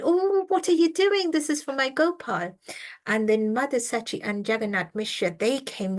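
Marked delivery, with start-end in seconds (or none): 0.0-2.5 high, loud startled cry of alarm; retold shout. The priest shouts at baby Nimai eating his offering, a high loud yell. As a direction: yell it out as if catching the child at the food. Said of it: "the brahmana just shouted".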